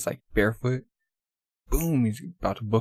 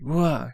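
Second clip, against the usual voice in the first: higher-pitched cartoon wail; mock yelp; 4 semitones higher than usual. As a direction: imitate a yelp from being hit. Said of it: "He's like, all beat up".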